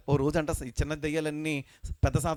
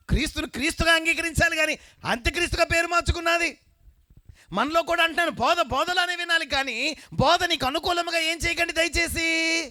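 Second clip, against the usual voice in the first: rising to a high, loud preaching cry; preaching build-up; 14 semitones higher, 4 dB louder than usual. Acted. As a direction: build the point up to a shout. Preacher exhorts congregation. (పెరుగుతున్న ఉత్సాహంతో ప్రసంగం)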